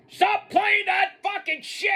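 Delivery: high, slow shout as an angry wrestler; pissed-off yell. Retold or imitated. Retelling a pissed wrestler in the production area, angry shout. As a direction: imitate a furious demand, drawn out high. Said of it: "He's pissed".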